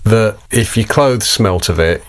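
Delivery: ordinary delivery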